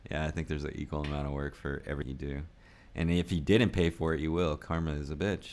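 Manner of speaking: ordinary delivery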